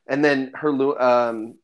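normal talking voice

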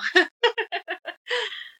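high giggle; high laugh. Real, not acted. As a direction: burst into a giggle. Laughing at the passage.

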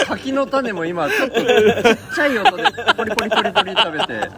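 laughing. Real, not acted. Guest laughs about eating snacks.

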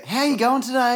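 high-pitched mock-friendly greeting; over-nice staff greeting. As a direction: gush it like over-eager restaurant staff. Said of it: "they're fucking too nice".

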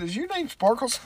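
hammed-up flirt through a car window; mock pickup line. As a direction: call it out high like a cheeky flirt. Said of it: "Should've pulled over and said".

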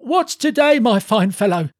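high, eager, hearty call. A narrator's reading. Scrooge calling to a boy in Sunday clothes, eager. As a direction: hail someone below, starting high. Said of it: "calling downward to a boy".

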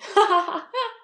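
high bright giggle; girl giggling at a friend; amused laugh. Acted. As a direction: laugh at your friend's fright. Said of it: "Mika started laughing".

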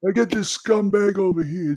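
Italian-American mobster accent; Tony Soprano. Shown as a demonstration. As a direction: grumble like a New Jersey Italian mobster. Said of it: "do the Tony Soprano thing".

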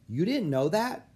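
high, puzzled disbelief; mildly incredulous kid; surprised question. A narrator's reading. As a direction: ask as if it's common knowledge. Olive surprised at Annie's ignorance.

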